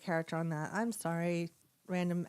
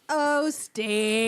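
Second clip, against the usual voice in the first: Californian drawl, a bit louder; weird accent; 3 semitones higher, 6 dB louder than usual. Acted. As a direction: play it as laid-back Californians. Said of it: "why do they sound like that".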